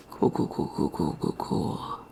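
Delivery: very soft, low repeated words; dazed muttering. Real, not acted. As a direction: mutter it under your breath, stunned. Dazed, upset after finishing the book.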